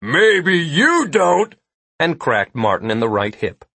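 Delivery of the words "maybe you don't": slow, drawn-out bellow, raised pitch. A narrator's reading. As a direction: bellow it, stretching every word. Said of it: "Nilo bellowed".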